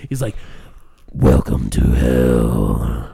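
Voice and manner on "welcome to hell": very low, slow, booming villain voice; Tony Todd voice. Retold or imitated. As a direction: drop the voice deep and drag it out. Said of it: "Why am I talking like this?".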